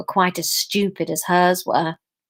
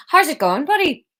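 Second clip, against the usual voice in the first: higher, lilting go at Irish; limited Irish accent; 6 semitones higher than usual. Shown as a demonstration. Reader settling on Theo's greeting, the Irish accent they can do. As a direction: do your best passable Irish accent, pitched up. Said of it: "I'll do the Irish accent I can do".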